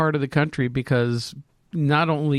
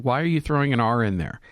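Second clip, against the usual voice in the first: ordinary talk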